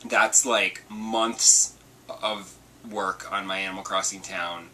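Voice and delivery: normal talking voice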